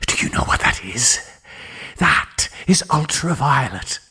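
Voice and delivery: a hint of a manic scientist, half hushed; lightly voiced Tillinghast. Tillinghast quizzing his puzzled friend, a lightly voiced eerie hush.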